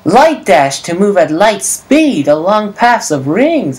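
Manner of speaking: slow, exaggerated sing-song Sonic voice; hammed-up, drawn-out cartoon hero; Sonic impression. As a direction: read it out as a badly imitated Sonic. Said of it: "really bad impression of Sonic".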